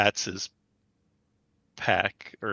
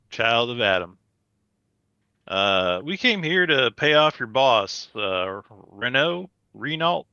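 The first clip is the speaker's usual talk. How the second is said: loud, drawling put-on Southern accent; 4 dB louder than usual. Player to gang guards, loud drawling put-on Southern accent. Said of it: "quit with the accent".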